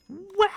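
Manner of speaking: high, loud startled yelp. Real, not acted. Streamer startled by the game, high loud yelp. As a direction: jump at the scare with a yelp. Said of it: "what noise did I just make".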